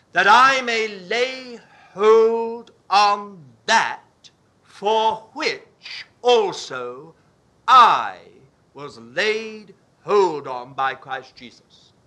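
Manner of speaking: slow dictation, every word held; slow school dictation. Shown as a demonstration. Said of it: "I will read it again".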